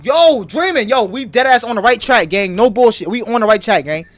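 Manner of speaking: loud, hyped and triumphant; hyped shouting. Real, not acted. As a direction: shout it to the whole stream. Hyped that the theory is right.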